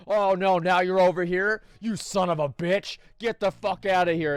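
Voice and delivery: dad screaming at a raccoon in his attic; dad yelling at raccoon. Retold or imitated. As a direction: bellow at the pest like an enraged dad. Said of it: "talking shit to this raccoon".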